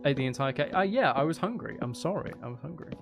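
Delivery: ordinary delivery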